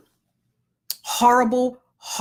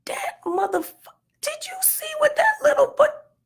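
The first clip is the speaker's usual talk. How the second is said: much higher, cracking into a crying yell; frustrated shriek. Retold or imitated. Mom venting to her husband in the bathroom, shrieking. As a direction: let it burst out as a strangled shout. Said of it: "And I say things like".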